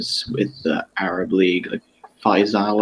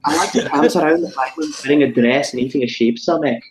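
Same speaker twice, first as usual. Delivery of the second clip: high, laughing mock Scottish accent; 6 semitones higher than usual. Pushed by friends to do it, a hammed-up Scottish accent. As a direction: ham up a silly Scottish accent while laughing. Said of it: "are you all expecting me to do it now".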